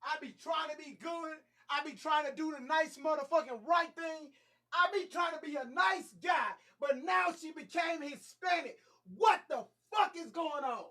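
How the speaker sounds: high-pitched, exasperated shouting rant; frustrated outburst. Real, not acted. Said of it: "take out a little frustration".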